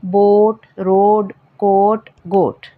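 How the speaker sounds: बोट रोड कोट गोट with a long o. Shown as a demonstration. Teacher giving students oa words with a long o. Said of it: "sound ओ की होती है".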